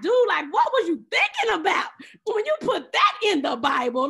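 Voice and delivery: loud, incredulous call out to God; exasperated outcry. Retold or imitated. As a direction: throw the question up loud, half joking. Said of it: "I'm like".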